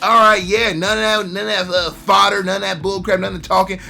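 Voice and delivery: loud, eager brushing-off of the chatter; impatient fan. Retold or imitated. Viewer telling the show to skip to the fight, loud and impatient. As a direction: wave off the talking, hungry for action.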